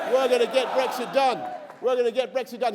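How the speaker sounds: rally shout. Real, not acted. Rally chant of campaign slogan.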